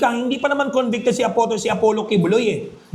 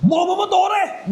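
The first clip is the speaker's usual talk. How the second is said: much higher mock shout of an angry netizen; angry rant; 10 semitones higher than usual. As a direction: rant like a furious citizen. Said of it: "galit na galit". (galit na sigaw ng taong nagrereklamo)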